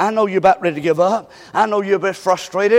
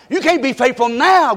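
ordinary delivery

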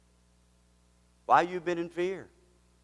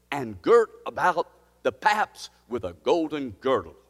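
higher, louder scripture read with force; preached scripture reading; 4 semitones higher, 4 dB louder, slower than usual. Acted. Pastor proclaiming scripture to his church, fervent. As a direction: proclaim the verse to the congregation.

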